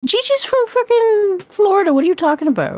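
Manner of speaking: high, incredulous protest; disbelieving outcry; incredulous outburst. Real, not acted. Arguing over Gigi's home state.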